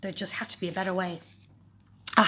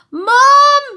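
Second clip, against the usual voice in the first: loud, high yell for mum; a child yelling loud and high for his mother; shout for mum; 18 dB louder than usual. A narrator's reading. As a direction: call mum for help at full volume. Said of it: "shouted Peter".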